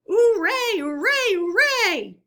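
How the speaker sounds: loud, high cheering hooray; crowd cheer. A narrator's reading. Weasels cheering at the banquet, loud high cheer. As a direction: cheer it out at the top of the voice. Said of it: "they heard".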